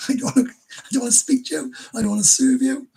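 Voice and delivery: put-on offended bar girl. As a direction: play the girl shutting him out.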